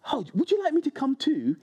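mock-eager Scott. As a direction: Scott playfully mimicking the ladies. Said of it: "and Scott went".

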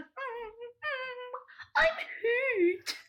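high, small pitiful whimper; mock hurt. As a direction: imitate a wounded man whimpering for pity. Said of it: "he has this look on his face like".

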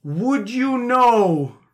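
put-on high, loud, slow Boston accent. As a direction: stretch it out in a thick Boston accent. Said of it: "a big Boston accent".

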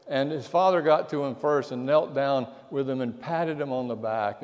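ordinary talk, nothing marked

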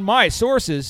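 ordinary talk, nothing marked

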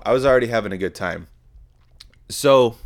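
ordinary talk

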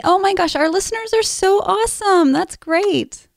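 thrilled, high-pitched praise; excited gushing. Real, not acted. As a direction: gush over wonderful news. Co-host reacts to new listener reviews.

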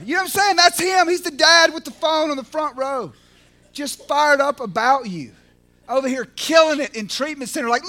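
ordinary talk, nothing marked

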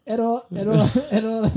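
high, loud robotic error alarm; robotic error message. Pretend. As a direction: glitch out like a broken robot. Robot malfunction.

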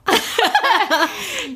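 bright burst of real laughter. Real, not acted. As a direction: laugh it off, embarrassed. Laughing at her own naivety.

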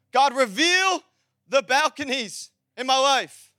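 "God, reveal" pushed out strongly, then each phrase held; emphatic prayer. Shown as a demonstration. Preacher modelling a prayer for church, emphatic declaration. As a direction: declare it with slow, spaced-out weight. Same prayer repeated with force.

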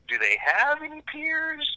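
incredulous question. Retold or imitated. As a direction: ask it in hushed, high awe. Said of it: "I'm like".

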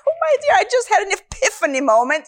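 high, fast, breathless wonder; thrilled exclamation. Retold or imitated. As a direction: gush about the discovery. Retold thrill after the vision.

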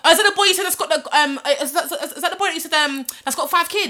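high, loud, quick coworker imitation; mock shouted question. Coworker asking about a man within earshot, mock shouting. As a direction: blurt it out for all to hear, stumbling over it. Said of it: "Why are you shouting that?".

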